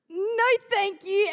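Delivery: high, slow, frightened no thank you; scared refusal. Retold or imitated. Man offers a ride, she refuses scared. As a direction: back away from a stranger's car in fright. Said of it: "I was freaking out".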